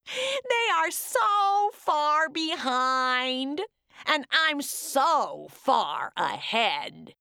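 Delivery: slow, high gloating boast. Acted. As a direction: savor being ahead. Gingerbread man gloating.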